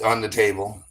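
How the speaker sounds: ordinary delivery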